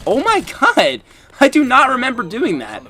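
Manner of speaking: high, loud disbelieving outburst; shocked. Real, not acted. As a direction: blurt it out in sudden shock. Shocked by own gameplay clip.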